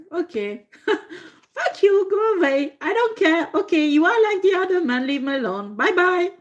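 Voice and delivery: giggling high imitation of her sending him off; her brush-off. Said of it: "she's like".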